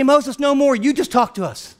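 high, loud acted plea; overwhelmed pleading. Terrified people beg Moses to speak instead, overwhelmed pleading. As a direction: beg off in dramatized alarm.

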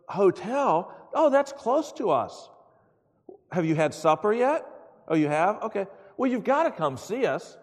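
high, loud, eager hostess on the phone; hammed-up delight at the caller; gushing phone hospitality. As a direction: imitate an over-friendly invitation. Acted wife's phone hospitality.

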